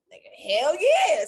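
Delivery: loud, high, emphatic agreement; eager yes. Retold or imitated. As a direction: jump at the offer, voice shooting up. Retold eager yes to the offer.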